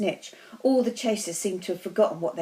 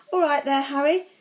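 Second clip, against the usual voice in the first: high, mildly raised call; light yell, pitched up; yelled greeting; 6 semitones higher, 4 dB louder than usual. A narrator's reading. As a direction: call out cheerily across a distance. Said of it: "he had time to yell".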